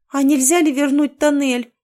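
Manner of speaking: high plaintive squeak. A narrator's reading. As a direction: squeak it out pitifully. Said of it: "жалобно пискнула я".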